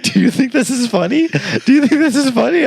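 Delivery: high-pitched, outraged mother voice; scolding mom. Retold or imitated. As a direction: imitate mom demanding an answer. Retold mom scolding her son.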